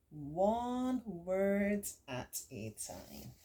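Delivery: drawn out slowly, one word at a time. Shown as a demonstration. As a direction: show how slow copying feels. Acting slow copying.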